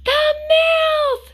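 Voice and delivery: shrill high loud shriek. A narrator's reading. Boy spotting the cave mouth, loud high shriek. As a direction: shriek it in sudden alarm. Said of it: "shrieked George".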